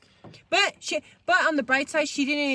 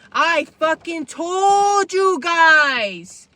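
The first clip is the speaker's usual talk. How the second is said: slow, higher, much louder angry yell; 4 semitones higher, 10 dB louder, much slower than usual. Retold or imitated. To friends after mom catches them, slow furious yell. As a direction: drag every word out in a furious shout. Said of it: "I was so angry".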